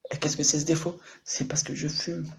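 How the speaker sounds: hushed, whispered question; confiding whisper. Pretend. Streamer teases his big flaw to viewers, whispering. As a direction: whisper it like a guilty secret.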